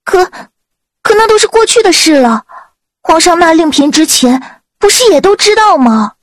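先迟疑，再高声急急辩解; 吞吐开口，音调拔高; 讷讷争辩. A narrator's reading. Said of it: "明玉讷讷半天". (讷讷地高声争辩)

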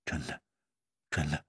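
low, faint murmur; small weak voice. A narrator's reading. Zhao insists to Yue Yang it's true, quiet weak voice. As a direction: insist feebly, very softly. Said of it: "小声地说".

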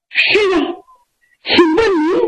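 high, youthful reply; questioning young man. Acted. Youth asking the old Taoist who he is, high-voiced.

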